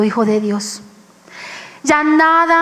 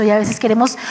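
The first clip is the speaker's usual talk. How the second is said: ordinary delivery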